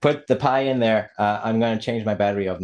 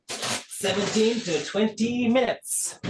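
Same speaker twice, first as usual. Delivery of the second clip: much higher, slow and drawn out; high drawn-out announcement; 14 semitones higher, much slower than usual. Pretend. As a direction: sing it out high and slow, teasing. Playful repeat of bake time.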